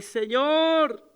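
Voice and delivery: a high, loud, falling cry of "Señor"; crying out to God. Retold or imitated. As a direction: cry out the name like a broken plea. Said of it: "empiezo a llorar y a clamar".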